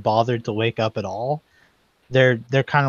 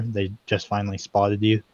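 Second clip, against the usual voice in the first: ordinary talk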